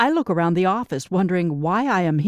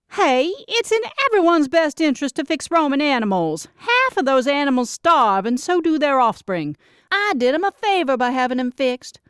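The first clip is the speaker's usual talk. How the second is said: higher, insistent sister voice justifying herself; younger sister, defensive; 7 semitones higher than usual. Acted. Younger sister defends fixing strays to her older sister, pitched up and insistent. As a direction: argue your case, pitched up.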